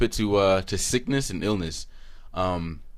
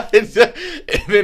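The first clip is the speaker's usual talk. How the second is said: laughing; 9 dB louder than usual. Real, not acted. Laughing about own dream.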